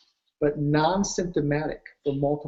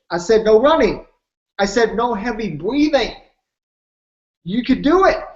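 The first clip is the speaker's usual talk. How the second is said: louder and higher, pressing each point; emphatic insistence; 4 semitones higher, 7 dB louder than usual. Retold or imitated. Pushing the doubting fireman to believe, loud and insistent. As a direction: drive it home to a doubter.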